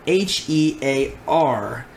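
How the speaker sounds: H E A R spelled out slowly; spelled out letter by letter. Shown as a demonstration. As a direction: spell out H E A R, each letter apart. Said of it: "I'll stress the here part".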